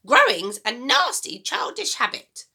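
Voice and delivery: put-on high, loud, bossy old woman; a mean grandma giving orders, high and loud; grumpy grandma voice. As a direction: nag the boy like a sour old granny. Grandma scolds.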